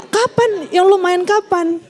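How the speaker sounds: high, slow prying question; nosy gossip. Retold or imitated. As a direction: dig for details like a busybody. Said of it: "jangan nanya". (nada kepo yang tinggi dan lambat)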